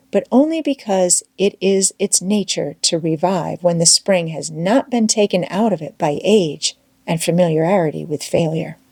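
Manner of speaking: usual speaking voice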